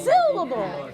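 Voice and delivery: "Syllable." tossed up high and loud on the first beat; shifted emphasis. Shown as a demonstration. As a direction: lean hard on the start of "Syllable.". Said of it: "the emphasis is on a different syllable".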